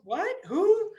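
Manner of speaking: baffled boss; startled what-who; confused manager. Retold or imitated. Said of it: "Manager's like".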